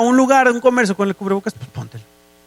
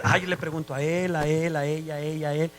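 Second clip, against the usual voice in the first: low, soft aside in another's voice; muttered mock quote; 6 semitones lower, 10 dB quieter than usual. Preacher mimicking someone asking everyone for advice, low and muttered. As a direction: run down the list under your breath.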